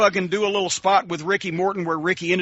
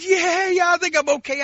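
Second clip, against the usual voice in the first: much higher, lightly strained reassurance; high-pitched Bubba; 12 semitones higher than usual. Retold or imitated. Bubba telling his friend he's okay: high squeaky voice. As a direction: play the big man's squeaky voice. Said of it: "Bubba had a high-pitched voice".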